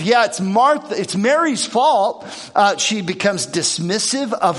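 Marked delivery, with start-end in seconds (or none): 0.0-2.3 defensive blame. Retold or imitated. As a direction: point the finger at Mary. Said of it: "She's defensive".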